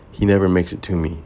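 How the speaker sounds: ordinary delivery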